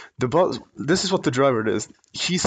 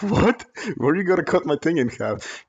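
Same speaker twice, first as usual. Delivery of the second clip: mock disbelief, voice jumping up; stunned what, then a baffled question; incredulous; 4 semitones higher than usual. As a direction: pitch leaps up on What. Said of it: "I was like".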